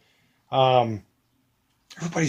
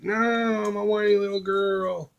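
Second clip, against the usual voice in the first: higher, whining little voice; mock whiny kid; 7 semitones higher, slower than usual. Son answering his father with a mock whine. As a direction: whine it like a sulky child. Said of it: "He's like".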